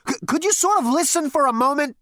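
high, loud, fast interruption; exasperated pleading. Acted. Zaphod begs his ancestor to listen, exasperated and fast. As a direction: stammer and push to be heard.

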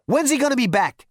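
loud, cocky and impatient; brash Zaphod. Acted. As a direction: throw your weight around. Zaphod asks.

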